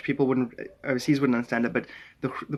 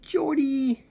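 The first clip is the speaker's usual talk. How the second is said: high, sustained name call; 13 semitones higher than usual. Real, not acted. Streamer greeting a viewer by name, calling out. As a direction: sing out the name high and long.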